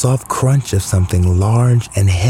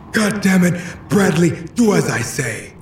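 angry father; 10 semitones higher, 3 dB quieter than usual. Acted. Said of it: "He was angry".

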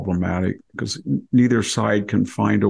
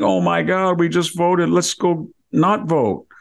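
high, alarmed sudden realisation; panicked regret; 9 semitones higher than usual. Retold or imitated. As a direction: gasp it out as a horrified change of heart. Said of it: "And then the next day you think".

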